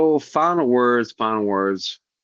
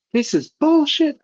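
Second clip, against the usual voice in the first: high, loud angry yell; 5 dB louder than usual. Retold or imitated. Owner yelling about cat vomit, loud angry yell. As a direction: blow up in frustration. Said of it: "I was yelling".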